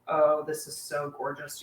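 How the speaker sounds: usual speaking voice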